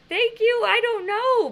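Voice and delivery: high, loud called-out reply; yelling back. Retold or imitated. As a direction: holler it back across the distance. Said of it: "I, I just yelled back".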